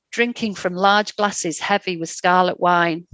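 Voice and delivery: plain reading voice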